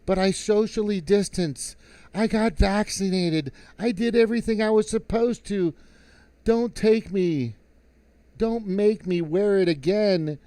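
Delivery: high, pleading mock screams of a woman; panicked lady. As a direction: whine it like a terrified strapped-down woman. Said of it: "like, screaming".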